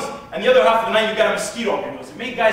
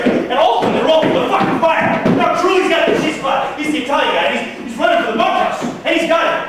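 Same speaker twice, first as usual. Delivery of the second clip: loud, high, breakneck storytelling; raised voice, urgent blow-by-blow; frantic excitement; 6 semitones higher, 5 dB louder than usual. Acted. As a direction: tell the scramble at a frenzied pitch. Frantic scramble for the burning cheesecloth.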